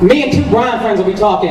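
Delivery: usual speaking voice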